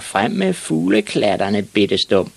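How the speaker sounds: drawn-out taunt, high then dropping; slow bully jeer; biggest bully. Acted. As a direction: order the little kid around, slow and mocking. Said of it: "sagde den største dreng".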